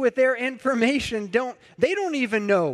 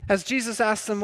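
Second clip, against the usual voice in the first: ordinary talk, nothing marked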